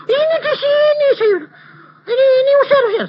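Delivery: high, loud panicked shriek with a laugh; screaming wife. Retold or imitated. Wife screaming at husband to chase the spirit away, shrill and loud. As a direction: shriek at her husband to drive it out. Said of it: "Istri saya teriak-teriak".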